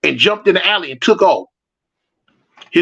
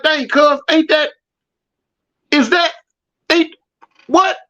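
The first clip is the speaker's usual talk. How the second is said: high, stammering disbelief; can't believe who he's seeing; startled double take; 7 semitones higher than usual. Retold or imitated. Spotting the rival across the street, startled and high.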